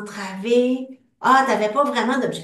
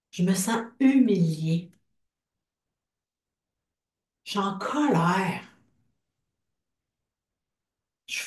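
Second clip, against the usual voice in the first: guided tapping script. Acted. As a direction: lead the group through each feeling, line by line. Leads an EFT tapping script.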